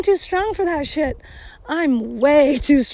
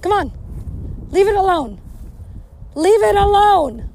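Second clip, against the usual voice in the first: calling out to dog; 6 semitones higher than usual. Real, not acted. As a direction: bark it out to the dog, pitch up. Yelling at dog again.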